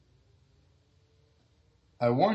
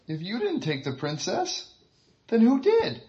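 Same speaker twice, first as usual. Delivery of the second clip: higher, rising to a loud squeak; confused hero, pitch jumping up; 11 semitones higher, 5 dB louder than usual. Acted. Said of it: "asked the hero".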